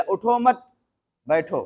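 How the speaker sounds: group it as उठो मत, pause, बैठो; pause after मत. Shown as a demonstration. Preacher reading it the other way, pause after मत. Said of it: "अब अगर पढ़ने वाले ने ऐसा पढ़ लिया".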